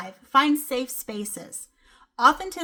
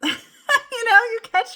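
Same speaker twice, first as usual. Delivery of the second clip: bursts out laughing, voice jumping high; real laugh, higher and louder; 12 semitones higher, 8 dB louder, slower than usual. Real, not acted. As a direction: laugh through the words. Laughs at her own habit.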